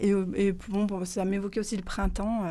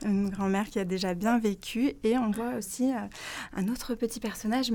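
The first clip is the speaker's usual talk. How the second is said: ordinary delivery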